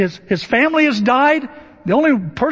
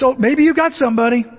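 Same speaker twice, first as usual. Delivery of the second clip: ordinary talk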